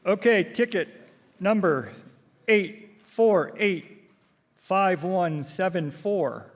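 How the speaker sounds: slow, drawn-out number calling; raffle caller. Acted. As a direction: read each digit out slowly to the room.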